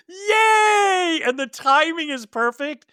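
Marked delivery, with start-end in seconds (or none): 0.1-1.2 long, high-pitched group hooray; imitated cheer. Acting out everyone cheering before the splatter, mock cheer. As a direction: hold it high like a celebrating crowd. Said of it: "they all go".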